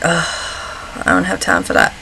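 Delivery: low, breathy mutter under the breath. Retold or imitated. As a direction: grumble it half-asleep. Groggy early-morning inner thought.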